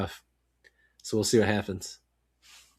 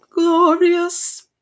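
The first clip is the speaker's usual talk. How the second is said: a much higher, louder gleeful cry; 8 dB louder than usual. Real, not acted. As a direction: savour the word, crowing it out. Gloating over rival's loss.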